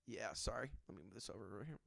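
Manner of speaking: very soft, low, half to oneself; under-the-breath aside while busy; muttered aside. Real, not acted. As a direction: drop your voice right down for a distracted apology. Muttered aside while moving something.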